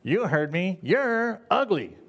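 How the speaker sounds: very high, stretched-out parrot voice; squawking parakeet. Acted. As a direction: insolent bird, pitch swooping way up. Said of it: "she looked at the parakeet".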